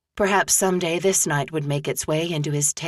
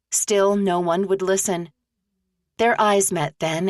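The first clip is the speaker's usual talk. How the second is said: ordinary delivery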